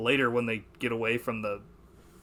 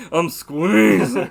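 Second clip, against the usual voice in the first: slow, louder, stretched-out leering delivery; drawn-out creepy line; 6 semitones higher, 12 dB louder, much slower than usual. Retold or imitated. Showing a friend how the actor said it, slow creepy drawl. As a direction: savor every syllable like a creep. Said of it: "the way he said".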